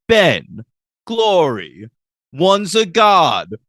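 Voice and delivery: sketch-comedy rhythm, each item set apart; high, loud, drawn-out list, mock explaining; Spike. Fan showing Spike in the parrot sketch rhythm, punched-out imitation. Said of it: "Spike just falls into that".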